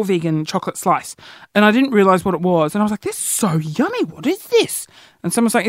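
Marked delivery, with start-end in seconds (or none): 3.0-4.9 high, swooping reenacted amazement; amazed delight. Recalling a first taste of vegan chocolate slice, amazed delight. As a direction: relive the astonished first taste, pitch leaping up on the question.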